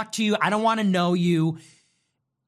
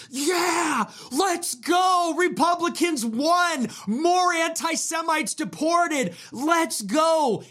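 much higher mock cheer, half shouted, slow in places; mock cheering cuck; 9 semitones higher, slower than usual. Mocked cuck cheering Republican wins from his chair, high mock shout. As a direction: ham up a gullible fan cheering his own defeat. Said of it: "in the cuck chair watching this all go down going".

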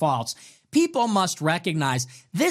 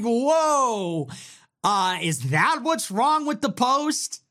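slow, put-on disbelief; mock baffled question, drawn out; incredulous; 4 semitones higher, slower than usual. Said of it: "I'm like".

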